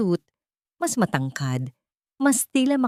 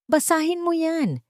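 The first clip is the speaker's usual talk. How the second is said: desperate outburst; 11 semitones higher than usual. A narrator's reading. Throws hospital paper at him.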